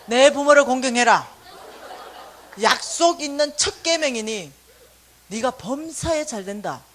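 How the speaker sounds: loud, slow sermon-like reciting; preachy scripture quote. Retold or imitated. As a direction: preach the verse at him. Mother quoting scripture sternly.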